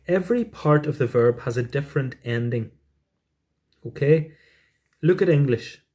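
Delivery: usual speaking voice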